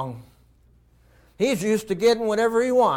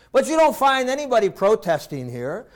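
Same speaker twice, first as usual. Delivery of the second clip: ordinary talk, nothing marked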